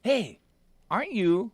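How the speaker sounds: high-pitched mock greeting; imitated excited fan. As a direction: imitate a man calling out in recognition. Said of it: "this dude got up and be like".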